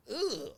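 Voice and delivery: high-pitched "ugh" of mock disdain; mock grunt. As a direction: grunt it up high, imitating scorn. Mocks how kids get labelled.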